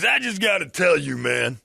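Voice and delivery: lightly voiced truck driver; mock gun guy. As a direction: call over from the truck. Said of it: "He goes".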